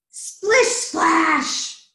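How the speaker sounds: loud, punched story sound effect; splashy sound effect. A narrator's reading. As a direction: make the words splash, high and loud.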